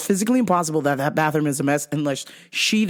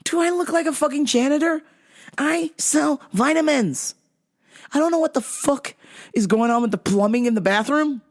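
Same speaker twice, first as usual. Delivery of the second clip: higher, fast exasperated rant; 8 semitones higher than usual. Retold or imitated. To the demanding customer, an exasperated rant. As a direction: vent like you're fed up with her. Ranting inner reply to customer.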